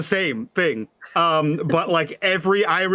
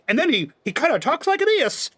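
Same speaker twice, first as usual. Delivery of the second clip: put-on, high-pitched character voice; silly character voice; 9 semitones higher than usual. Shown as a demonstration. Player trying out a character voice, self-consciously silly. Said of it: "you're trying to portray a, a character".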